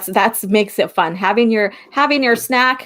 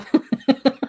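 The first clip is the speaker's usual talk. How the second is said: giggle; 4 semitones higher than usual. Real, not acted. Joking about April Fools.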